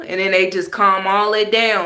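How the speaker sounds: normal talking voice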